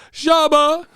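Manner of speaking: high, loud drawn-out name call; mock shout. Calling out chat member's odd name, high shout.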